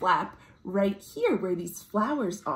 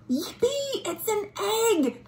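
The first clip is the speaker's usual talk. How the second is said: put-on thrilled discovery for the kids; high excited cheer; excited gasp; 12 semitones higher than usual. Finding egg in tree.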